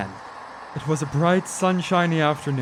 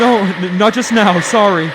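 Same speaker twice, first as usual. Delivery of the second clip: nervous refusal; 5 semitones higher, 8 dB louder than usual. Acted. Driver nervously refusing the hitchhiker.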